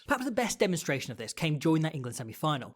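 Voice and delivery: normal talking voice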